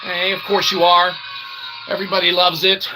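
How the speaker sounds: normal talking voice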